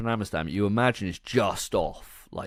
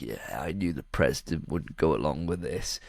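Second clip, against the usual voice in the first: low, self-satisfied put-on voice; imitation of a smug know-it-all; 7 semitones lower, 4 dB quieter than usual. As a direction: drop the pitch and act smugly knowing. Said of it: "smug as fuck".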